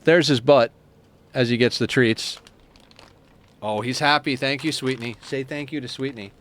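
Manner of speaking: ordinary talk, nothing marked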